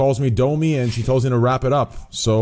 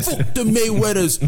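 lightly mimicked fighter's brag, pitched higher; Conor McGregor; 7 semitones higher than usual. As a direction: do a quick mock of a trash-talking fighter. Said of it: "to fucking Conor McGregor".

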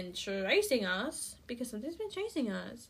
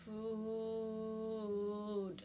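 one long, drawn-out droning moan; dazed mock groan for food; calling for food; 7 dB quieter than usual. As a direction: stretch the word out on a single held note. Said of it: "He's out here calling for food".

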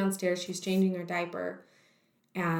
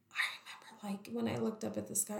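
whispered; 9 dB quieter than usual. Real, not acted. Recalling the dark moment.

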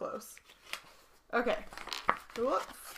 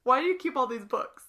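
role play voice; 5 semitones higher, 6 dB louder than usual. Pretend. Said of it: "I'm getting into the role play".

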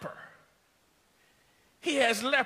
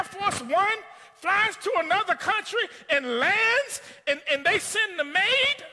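incredulous preaching; 7 semitones higher, 3 dB louder than usual. Acted. Excited indignant preaching.

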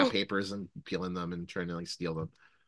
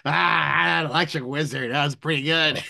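put-on obnoxious drunk voice; 6 semitones higher than usual. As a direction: slur it like a rowdy drunk. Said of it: "that's my obnoxious drunk voice".

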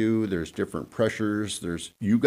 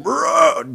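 rolled brrr imitating a deer roar; deer croak; 6 dB louder than usual. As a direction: growl a buck's croak. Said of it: "the croak sound".

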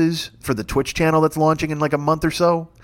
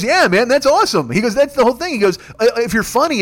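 high, eager and a bit louder; enthusiastic; 7 semitones higher, 3 dB louder than usual. Retold or imitated. Jaden hyping a Burger Time stream, eager and high. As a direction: pump it up like a fan who loves the idea.

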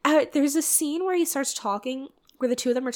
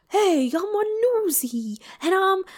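bad New York accent; 6 semitones higher than usual. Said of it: "Terrible New York accents".

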